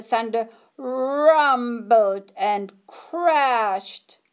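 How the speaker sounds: dramatic thunder narration; rumbling narration. A narrator's reading. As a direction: draw out the rumble and crash.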